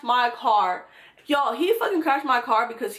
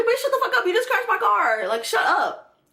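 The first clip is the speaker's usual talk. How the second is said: mock snapping back; 9 semitones higher than usual.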